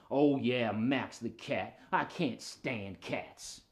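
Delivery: low, slow, soft dog voice; grumpy puppet dog. Pretend. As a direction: grumble slowly about a rival.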